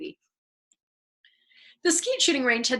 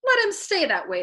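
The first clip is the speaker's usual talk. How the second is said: a raised, spiteful pronouncement; vindictive; 8 semitones higher, 4 dB louder than usual. A narrator's reading. Colonel deciding to keep the chaplain at attention, vindictive. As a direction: spit it out to put the chaplain in his place. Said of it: "the colonel decided vindictively".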